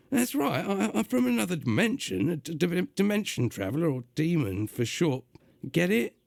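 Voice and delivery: gruff, high London demon voice; gruff London accent. Acted. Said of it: "doing a gruff, uh, London accent".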